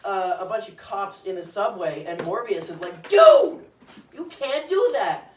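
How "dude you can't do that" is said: high, loud yell of protest; outraged yell. Retold or imitated. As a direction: scream it like you can't believe it. Morbius yelling at Neil.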